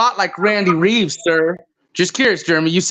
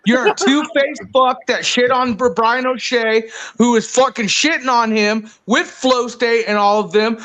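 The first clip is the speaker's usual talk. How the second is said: ordinary talk, nothing marked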